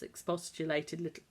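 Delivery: usual speaking voice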